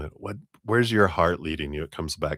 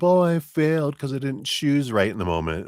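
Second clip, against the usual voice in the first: guilty self-talk; 7 semitones higher than usual. Retold or imitated. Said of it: "guilt and beating ourselves up".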